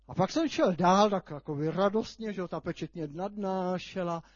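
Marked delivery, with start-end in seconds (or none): none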